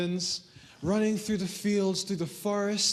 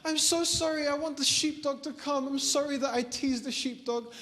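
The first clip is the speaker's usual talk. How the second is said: put-on high-pitched tearful child's wail; mock crying plea; 9 semitones higher than usual. Boy crying for the shepherd to come, acted tearful pleading. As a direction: beg in a hammed-up crying voice. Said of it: "He started crying".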